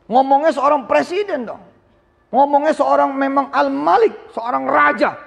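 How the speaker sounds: high, rising preacher's call; impassioned sermon push; fervent exhortation. Acted. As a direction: rouse the students to aim high.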